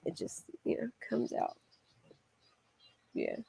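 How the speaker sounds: trailing off in a whisper; whispered aside. Real, not acted. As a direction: let it slip out under breath.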